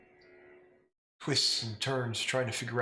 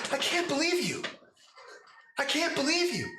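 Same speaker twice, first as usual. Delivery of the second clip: exasperated disbelief. Acted. Adult Lila pulling her hair at the player, high exasperated. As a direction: repeat it tearing your hair out. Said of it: "she pulls her hair".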